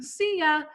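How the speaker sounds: See ya said high and bright. Shown as a demonstration. Teacher likening silla to see ya, high. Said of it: "Kinda like if you're saying".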